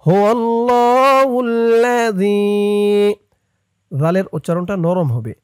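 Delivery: slow, chanted tajweed recitation; Quran recitation. Shown as a demonstration. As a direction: recite it melodically for students to follow. Teacher recites verse again.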